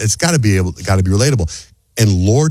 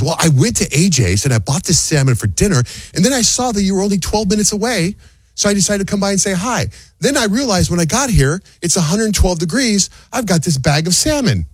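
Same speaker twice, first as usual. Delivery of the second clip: high, sweet mock wife voice; lightly mocking impression of his wife; imitating wife; 11 semitones higher than usual. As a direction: play the wife, pitched way up and chirpy. Wife voiced in retold story.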